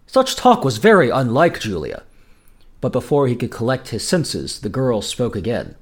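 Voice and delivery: ordinary delivery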